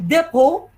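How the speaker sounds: DEPOT said with no T at the end; silent T. Shown as a demonstration. Teacher models Depot for students to repeat, silent T. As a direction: say "Depot" ending on an open O, T silent. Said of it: "T silent".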